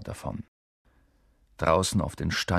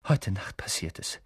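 secretive; 5 dB quieter than usual. A narrator's reading. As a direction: say it under your breath, out of earshot. Secret plan, teacher just out of earshot.

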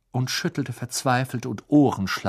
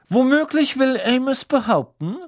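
kalte Wut; 5 dB louder than usual. A narrator's reading. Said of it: "mit kalter Wut in jeder Silbe".

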